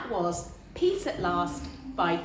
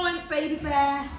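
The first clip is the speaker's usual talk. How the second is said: usual speaking voice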